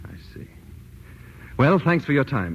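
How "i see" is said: whispered aside. Acted. Detective quietly probing a clerk.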